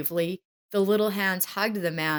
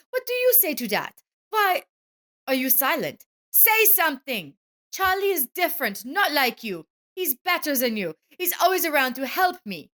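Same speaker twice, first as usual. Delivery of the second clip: defiant outburst; 8 semitones higher than usual. Acted. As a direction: throw it in his face. Wife confesses, quarrel.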